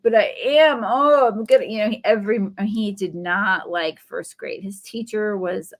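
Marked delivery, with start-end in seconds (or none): 0.0-1.6 put-on whiny kid. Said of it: "And I'd be like".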